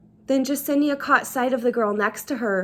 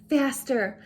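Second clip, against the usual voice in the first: ordinary delivery, nothing marked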